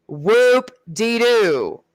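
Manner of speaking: very slow, dragged-out "Whoop-de-doo" with a fall; sarcastic drawl. Acted. Mocking his Special Forces plans, slow sarcastic "Whoop-de-doo". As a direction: stretch "Whoop-de-doo" out, unimpressed.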